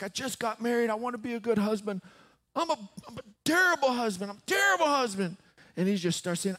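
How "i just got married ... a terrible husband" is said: high, rushed, anguished retelling; imitated weeping confession. Newlywed confessing to the pastor, imitated weeping. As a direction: act out his self-reproach, pitch climbing. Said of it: "he's just weeping".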